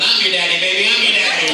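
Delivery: a big mock yell; shout. Shown as a demonstration. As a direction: yell it out with gusto. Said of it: "as you shout out".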